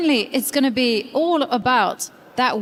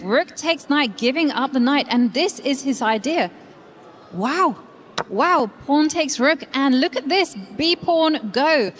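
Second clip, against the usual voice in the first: excited; 3 semitones higher than usual. Real, not acted. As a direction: build up to an excited call. Sudden brilliant move in commentary.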